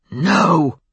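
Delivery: loud, forceful roared refusal. A narrator's reading. Wolf refusing to have Bran called, roaring. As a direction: burst out with one roared word. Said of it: "The word left him in a roar".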